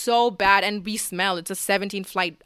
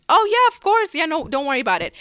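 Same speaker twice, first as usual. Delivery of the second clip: much higher and louder, gushing agreement; eager yes; 7 semitones higher, 4 dB louder than usual. Retold or imitated. Answering the girl's written photo request, excitedly high and loud. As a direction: jump at the request, thrilled. Girl asks for a photo in writing.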